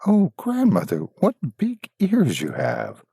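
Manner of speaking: put-on high, slow, wide-eyed girl; wondering girl. Girl questioning the disguised wolf, high and slow. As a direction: marvel at each strange feature.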